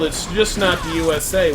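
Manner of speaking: ordinary talk, nothing marked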